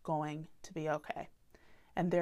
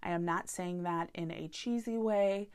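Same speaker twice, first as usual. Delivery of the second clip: ordinary talk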